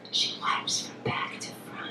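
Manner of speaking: breathy secret whisper. Pretend. Mother warning daughter's dates, whispered secret. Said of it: "I'm gonna whisper in their little ears".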